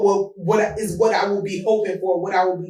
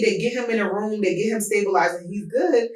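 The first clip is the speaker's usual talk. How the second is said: normal talking voice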